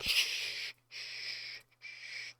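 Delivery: soft soothing shushing; whispering over a crib. Retold or imitated. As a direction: hush it like a baby to sleep. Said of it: "put the system in a headlock and it's just like".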